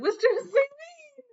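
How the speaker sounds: high, piercing mock-student shout of the teacher's name; mocking student call. Teacher mocking his class as a student calling out. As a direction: squeal the name like a cheeky kid. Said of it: "I'm just mocking my class".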